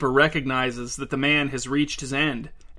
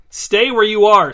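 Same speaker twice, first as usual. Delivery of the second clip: loud, higher barked order; sharp snap; 6 semitones higher, 9 dB louder than usual. A narrator's reading. Wife ordering Fedya not to leave, snapping loudly. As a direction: snap it to stop him in his tracks. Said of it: "snapped his wife".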